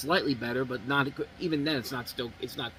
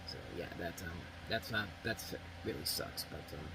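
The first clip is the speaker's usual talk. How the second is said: much softer, fading, near-whispered grief; subdued sorrow; 8 semitones lower, 13 dB quieter than usual. Real, not acted. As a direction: drop to a hushed, shaken murmur. Reacts to director's death.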